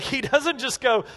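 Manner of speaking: normal talking voice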